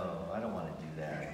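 low, soft, quick reluctant reply. Retold or imitated. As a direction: balk at it under your breath, acting a retold protest. Child balks at mother's instruction.

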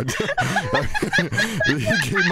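breathless nervous laughter; laughing. Real, not acted. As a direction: laugh off the fright. Laughing after jump scare.

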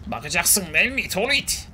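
mock gruff mobster father; villain father impression. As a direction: growl it like a TV crime boss. Villain father line again.

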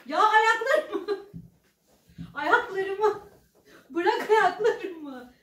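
slow, laughing pleading; being tickled. Real, not acted. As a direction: beg through helpless laughter. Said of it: "İnanılmaz gıdıklanıyorum". (gıdıklanırken gülerek, yavaş yalvarma)

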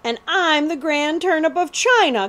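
a touch of a snicker, mocking the boast; snickering mockery. A narrator's reading. Outlaw scoffs at the prince's claim, snickering mockery. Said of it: "Cutwater snickered".

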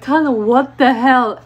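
retold outraged outburst; exasperated scolding. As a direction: imitate friends shouting in disbelief. Friends scold her after encounter.

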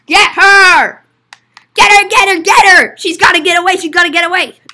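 high, very loud, repeated shouts; urgent shouting. Acted. Shouting as the customer gets away, urgently. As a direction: shout for help catching someone escaping. Fleeing customer must be caught.

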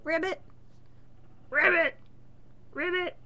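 croaky frog ribbits, rising each time; frog croaks. Retold or imitated. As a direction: mimic frogs calling back and forth. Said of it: "frogs are talking to each other".

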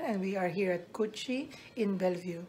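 usual speaking voice

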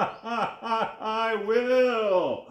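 high, drawn-out villain gloat; hammed-up shark sneer; mock sneering. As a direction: laugh your way into a gloating shark. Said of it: "sneered the shark".

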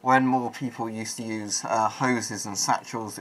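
muffled behind mask visor; speaking inside respirator facepiece; through a gas mask. Real, not acted. Seen 5: Full-face respirator worn over mouth in every frame while speaking.

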